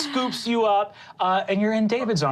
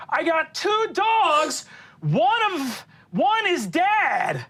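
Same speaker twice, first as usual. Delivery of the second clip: David's voice; 9 semitones higher, slower than usual. Acted. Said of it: "and he goes".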